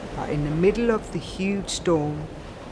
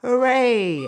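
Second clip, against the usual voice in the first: loud, high cheer; shouted; 9 semitones higher, 6 dB louder than usual. A narrator's reading. Everyone cheers as windows open, shouted. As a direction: shout it for joy. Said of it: "everyone shouted".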